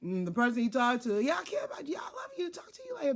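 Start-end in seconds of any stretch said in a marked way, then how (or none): 1.2-3.1 high mock lovey-dovey voice; hammed-up phone sweet talk, pitched way up; sweet-talking boyfriend. Mimics man sweet-talking partner on phone.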